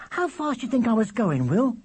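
put-on very high, eager child's voice; piercing little brother. A narrator's reading. Charlie plying his brother with questions, piercing. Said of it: "as loud and piercing as ever".

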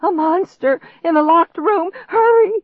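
high, loud frantic shriek; panicked shriek. A narrator's reading. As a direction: shriek a desperate warning. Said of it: "I shrieked".

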